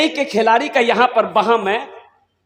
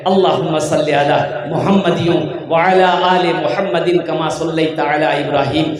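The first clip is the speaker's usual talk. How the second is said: low, melodic Arabic durood recitation; devotional recitation; 3 semitones lower than usual. Real, not acted. Leading everyone in durood sharif, devotional recitation. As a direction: recite the blessing in a low measured chant.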